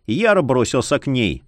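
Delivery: ordinary delivery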